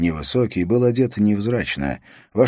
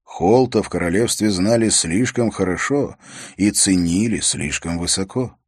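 ordinary delivery

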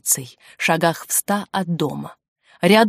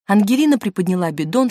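ordinary delivery, nothing marked

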